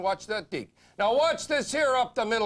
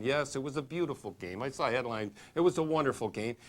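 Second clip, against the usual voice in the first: mock quiet player voice; 8 semitones lower, 5 dB quieter than usual. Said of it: "he'll be in the association".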